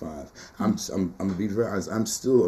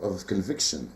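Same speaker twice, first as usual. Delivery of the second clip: ordinary talk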